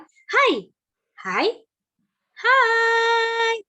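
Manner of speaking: high, loud sing-song "Hai" with a long held last one; playful intonation. Shown as a demonstration. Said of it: "intonasi yang kita keluarkan". ("Hai" dinaikkan tinggi dan dipanjangkan)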